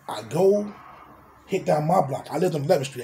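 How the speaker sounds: normal talking voice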